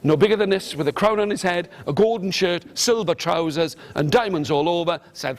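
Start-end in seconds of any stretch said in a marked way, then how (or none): none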